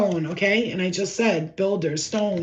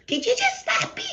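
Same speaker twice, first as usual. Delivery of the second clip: much higher, outraged squeak of a question; shocked she got slapped; indignant disbelief. Retold or imitated. Elf-self asking the guide after a slap, indignant. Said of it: "And I was like".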